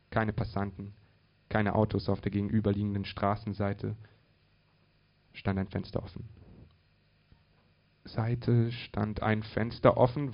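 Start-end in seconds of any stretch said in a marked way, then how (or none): none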